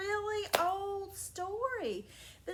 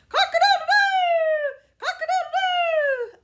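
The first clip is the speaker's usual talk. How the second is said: much higher, loud crowing rooster; 13 semitones higher, 14 dB louder than usual. Acted. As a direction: crow it out like a frantic rooster. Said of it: "he was going".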